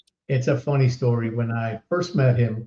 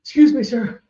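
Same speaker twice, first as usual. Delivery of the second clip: high, louder, breathless fan voice; breathless excited kid; 10 semitones higher, 5 dB louder than usual. Retold or imitated. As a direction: play the starstruck kid running up to you. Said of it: "he's, like, out of breath".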